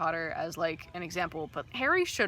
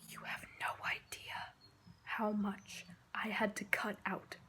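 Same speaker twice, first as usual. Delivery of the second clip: whispering; 9 dB quieter than usual. Real, not acted. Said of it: "whispered aside while editing".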